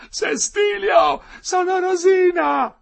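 piercingly high, drawn-out cry; woman shouting out. A narrator's reading. Rosina calling to her man in prison, shouting. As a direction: yell the name to reach him.

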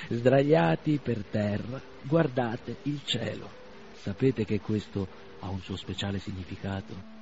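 very slow, savoring words; slow musing old man. Acted. Old doctor musing on lying under the sky, very slow. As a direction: dwell on it gently.

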